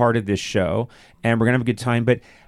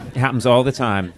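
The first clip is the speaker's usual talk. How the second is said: ordinary talk, nothing marked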